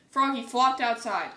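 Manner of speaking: ordinary delivery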